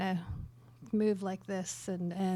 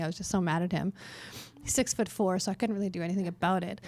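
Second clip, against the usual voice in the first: ordinary talk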